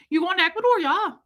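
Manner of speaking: very high Midwestern lilt; Midwestern accent. Retold or imitated. Stranger chats with traveler bound for Ecuador, Midwestern accent. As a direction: chirp it like a friendly Midwesterner. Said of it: "Get back to your Midwestern".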